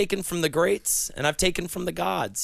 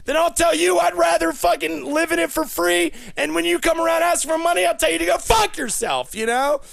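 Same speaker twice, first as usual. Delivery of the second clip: angry rant; 9 semitones higher than usual. Acted. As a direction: work yourself into a furious rant at the crowd. Ranting at audience, escalating.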